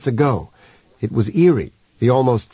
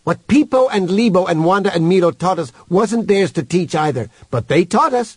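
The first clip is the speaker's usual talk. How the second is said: lightly voiced alien character, somewhat raised pitch; Human arguing back; 6 semitones higher than usual. Human argues with Leaf Eater over who taught them, insistent. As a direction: argue the point in a higher voice.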